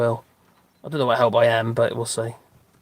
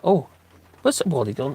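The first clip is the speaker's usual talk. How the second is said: normal talking voice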